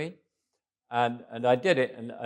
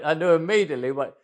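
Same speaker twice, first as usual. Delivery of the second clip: normal talking voice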